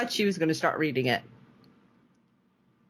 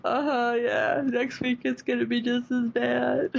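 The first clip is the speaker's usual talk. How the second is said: higher voice, half crying through the words; answering her husband while crying over the episode, higher and teary; retold crying; 8 semitones higher than usual. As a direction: replay tearful agreement, voice catching. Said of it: "So I'm like".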